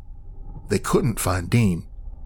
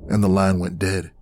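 plain reading voice

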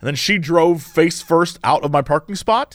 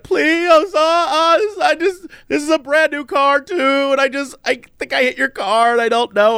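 mock crying; 8 semitones higher than usual. Distraught driver pleading with the car's owner, mock-crying in a high voice. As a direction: imitate a tearful, pleading apology.

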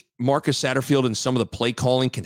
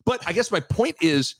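ordinary delivery

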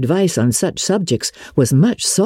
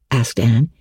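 ordinary delivery, nothing marked

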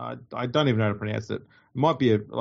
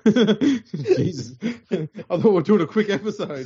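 laughing; 6 semitones higher, slower than usual. Real, not acted. Co-host launches absurd scrum explanation.

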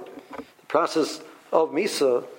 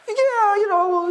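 high-pitched, wavering hope; acted-out iffy optimism, pitched way up; doubtful hedging; 3 dB louder, slower than usual. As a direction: hedge it in a much higher voice. Voicing someone half-hoping for lost item.

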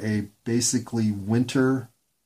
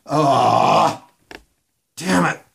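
loud frustrated groan; 3 semitones higher, 6 dB louder, much slower than usual. Real, not acted. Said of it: "I said her name".

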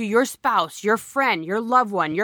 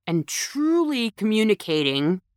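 ordinary delivery

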